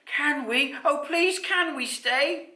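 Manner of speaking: put-on high, loud pleading child; excited youngster, slowing on the plea; Raymond. A narrator's reading. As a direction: beg a parent eagerly. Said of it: "Raymond asked".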